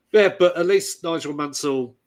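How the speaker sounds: usual speaking voice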